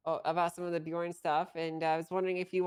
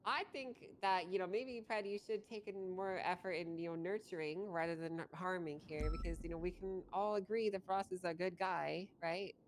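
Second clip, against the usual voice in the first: calm soothing; 9 dB quieter than usual. Acted. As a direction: gently talk them down. Soft mediating appeal.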